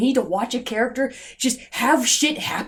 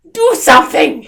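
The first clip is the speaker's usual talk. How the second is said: much louder, yelling at the screen; exasperated shout; 4 semitones higher, 11 dB louder than usual. Real, not acted. Reviewer yelling at the passive heroine, loud shout.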